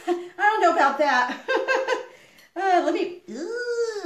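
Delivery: real laughter breaking through the words; giggling at her own joke; laughing. Real, not acted. Laughing off her own boast to the camera.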